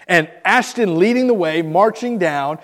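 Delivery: normal talking voice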